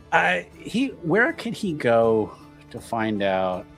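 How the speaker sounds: usual speaking voice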